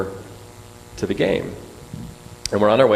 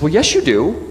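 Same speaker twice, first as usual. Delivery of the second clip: put-on insistent child; 9 semitones higher, 7 dB louder, slower than usual. Daughter insisting in story.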